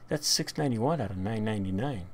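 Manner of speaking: ordinary talk, nothing marked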